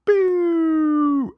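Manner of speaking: squeaky high mock shout; silly high yell. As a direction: yelp it like a cartoon goon. Mocking helmet guy's line.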